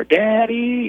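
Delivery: put-on high little-girl call of daddy; child calling daddy. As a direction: call out like a sleepy two-year-old. Daughter calls him at night.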